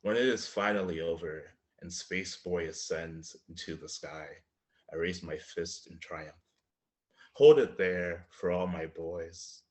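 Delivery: measured, deliberate poem delivery with swells; poetry reading. Acted. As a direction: read it like a poem to a live audience. Poet reads his poem.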